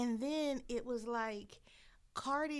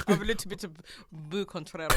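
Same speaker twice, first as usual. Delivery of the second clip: mock French accent; 5 semitones lower, 3 dB louder than usual. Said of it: "you sound like you French".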